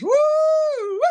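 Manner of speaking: high, loud whooping cheer; a real burst of cheesecake excitement; excited whoop. Real, not acted. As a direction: whoop it out, fired up. Fired up over cheesecake.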